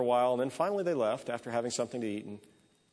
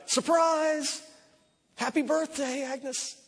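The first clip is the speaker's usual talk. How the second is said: surprise shout; 13 semitones higher, much slower than usual. Acted. Women jumping out at Agnes, shouting surprise. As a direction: leap out and shout it. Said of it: "and shout".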